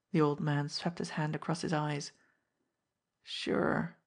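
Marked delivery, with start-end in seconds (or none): 3.2-4.0 unvoiced thick murmur; choked, barely voiced. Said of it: "he said thickly".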